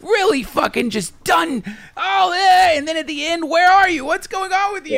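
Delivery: high, pushing, half-shouted rant; worked-up rant. Real, not acted. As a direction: build it into a frustrated outburst. Ranting about empty hedonism.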